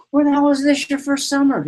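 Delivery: high-pitched incredulous teasing; mock amazement. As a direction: pitch it way up, as if baffled. Teasing Mary Lou after her success.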